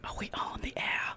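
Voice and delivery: soft unvoiced whisper. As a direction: ask in a hush. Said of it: "You gotta get the whisper in there".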